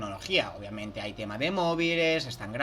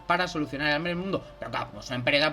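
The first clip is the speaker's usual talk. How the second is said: ordinary talk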